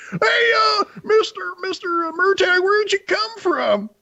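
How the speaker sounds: very high, eager chirpy pigeon; excited pigeon. Acted. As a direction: squeal questions like a thrilled little bird. Pigeon chatters at Murtagh.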